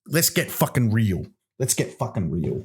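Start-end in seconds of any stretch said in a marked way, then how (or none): 1.6-2.7 lower, softer, put-on husky repeat; mock sultry. Host repeating himself low, cohost calls it kinky, mock sultry. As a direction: drop it low and soft, teasing. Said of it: "Oh, kinky".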